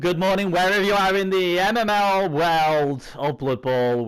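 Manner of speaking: high, booming welcome to the listeners; show-opening announcer. Acted. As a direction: announce it big, stretching out the last words. Opens the show.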